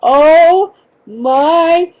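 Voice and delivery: loud, high, drawn-out horror; retold agony of embarrassment, each word separate; stretched-out appalled groan; mortified dismay. Mortified reaction to second yell.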